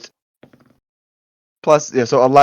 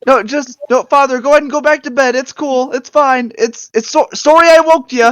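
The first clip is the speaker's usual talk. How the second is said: higher, hurried fake Canadian voice; apologetic Canadian guard accent; 9 semitones higher, 4 dB louder than usual. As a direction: soothe him in a put-on Canadian accent. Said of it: "I have a Canadian accent".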